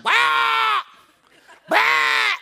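loud, high mock peacock squawk; screeching peacock imitation.

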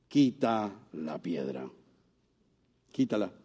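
low, soft, slow "Quita la piedra"; hushed command. Acted. Preacher voicing Jesus at the tomb, hushed slow command. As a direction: speak the order softly and slowly, almost to yourself.

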